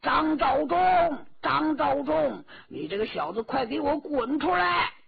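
拖长字音的高声怒喊; 扯开嗓子点名叫阵，喊得又高又响; 大声叫阵. A narrator's reading. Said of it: "吴尘大叫". (高声大叫，向敌人叫阵)

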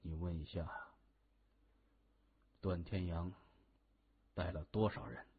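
low, hushed instruction; discreet order. A narrator's reading. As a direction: keep it from the phone's other end. Covers mouthpiece, secretly orders aide. (压低嗓音悄悄吩咐)

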